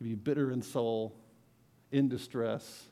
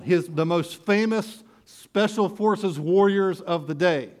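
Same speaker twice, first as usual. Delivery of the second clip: full-force preaching; 6 semitones higher, 8 dB louder than usual. Acted. Preacher hyping David's mighty men to the crowd, full-force preaching. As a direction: build awe over the mighty men.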